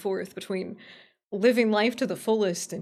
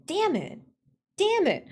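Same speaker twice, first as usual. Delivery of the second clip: high, falling yelp of frustration; exasperated cursing; 8 semitones higher than usual. Real, not acted. Streamer stuck on the alert settings, exasperated cursing. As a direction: curse sharply in a high pitch.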